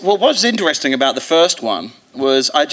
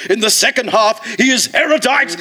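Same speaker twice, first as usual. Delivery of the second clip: 1 mock reviewer; 8 semitones higher than usual. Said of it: "every reviewer ... said".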